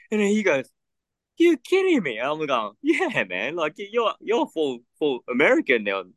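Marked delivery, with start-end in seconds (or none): 1.4-2.2 imitated disbelief, very high-pitched; incredulous retort. Acted incredulous reply from the manager. 2.8-6.0 high-pitched mock insistence; teasing retort. Acted teasing reply about lost accent.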